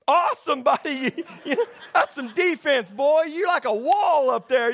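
loud, high-pitched bragging dad with a laugh; hammed-up proud sideline boasting; mock trash talk. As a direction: hype up your kid from the sideline. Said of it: "I'm talking junk to".

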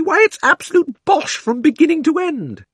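put-on very high, loud girlish indignation; indignant shout. Polly shouting down the Queen's story, indignant. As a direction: fling the denial at her. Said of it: "shouted Polly".

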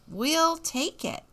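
high, slow, happy acceptance; delighted acceptance. Acted. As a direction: take it gladly as Jack. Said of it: "said Jack and his friends".

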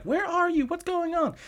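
very high falsetto mock-mom voice; fretting mom. Mom checking on her daughter by phone, high mock fretting. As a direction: imitate a mother in a shrill-pitched falsetto.

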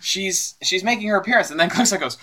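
usual speaking voice

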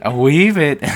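high, loud Scottish "wee bit"; Scottish accent. Shown as a demonstration. Copying dad's Scottish accent on request, loud and high. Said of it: "Yeah, like that".

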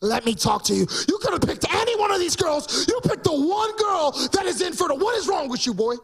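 scolding mother-in-law. Pretend. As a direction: play the nagging mother scolding her boy. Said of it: "She's probably like".

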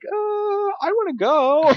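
high, small, reluctant voice; timid protester voice. Retold or imitated. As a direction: squeak it out like a scared recruit. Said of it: "the third line was gonna be like".

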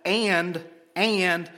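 each "and" set apart and drawn out; stressed "and". Shown as a demonstration. Preacher shows the congregation the repeated word, each "and" stressed. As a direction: land firmly on "and", then "and". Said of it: "shows emphasis on all the things".

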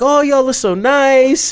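high, slow, sweet lady voice; gushing call center lady. Retold or imitated. Call center ladies thanking the hackers, high sweet coo. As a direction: coo it like a grateful receptionist.

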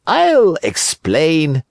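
high, slow, sly fox; sly fox warning. Acted. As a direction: warn him slowly, sweetly threatening. Fox speaks.

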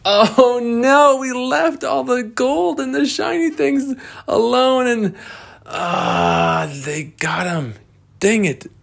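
very high dismayed voice; high dismayed character voice. Acted. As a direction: lament the stolen gold. Treasure stolen, dismay.

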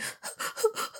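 quiet, breathy little sobs; short soft whimpering puffs; small sobs. Shown as a demonstration. Showing viewers what her crying wasn't, small quiet sobs. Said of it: "I'm not crying like".

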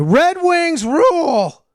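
high, slow mock cheer yell. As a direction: belt it out like a hockey fan.

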